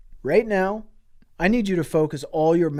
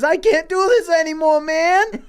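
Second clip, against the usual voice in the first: hammed-up, higher, half-laughing whine; mock despair; 10 semitones higher than usual. Murr before jumping, a mock teary whine. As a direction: fake a tearful cry while cracking up. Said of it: "he says".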